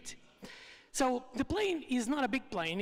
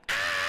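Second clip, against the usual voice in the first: loud short scream of fear; mock crowd scream; 6 dB louder than usual. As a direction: yell Ah as the plane dips. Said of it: "everybody starts to scream".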